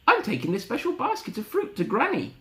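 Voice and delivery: put-on high-pitched little girl; Little Red. Little Red answering the wolf, high girlish voice.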